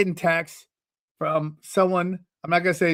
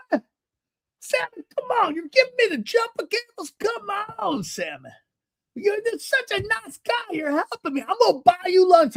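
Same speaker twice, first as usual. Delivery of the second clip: put-on very high, gushing old-man voice; overjoyed older man. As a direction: protest the gift with high-pitched delight. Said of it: "It's how he talks".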